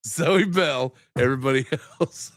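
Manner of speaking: laughing through his own line; giggling as he repeats the bar. Real, not acted. Repeating his Australian bar to the listeners while laughing.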